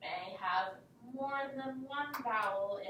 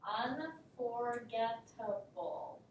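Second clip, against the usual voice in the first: slow syllable count; slower than usual. Shown as a demonstration. Teacher gives word to decode.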